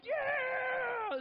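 very high, loud celebratory yell; mock victory yell. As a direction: shout like you already won. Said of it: "they're like".

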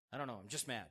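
low, soft acted sulk; mock sulky reply; sullen. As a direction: grumble it quietly, half to yourself. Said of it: "You're just in anger".

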